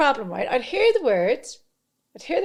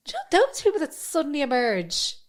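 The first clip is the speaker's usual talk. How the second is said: ordinary talk, nothing marked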